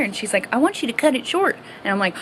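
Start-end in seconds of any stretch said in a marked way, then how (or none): 0.5-1.6 higher and quicker mom voice; mom's quick, pitched-up request; imitating mom.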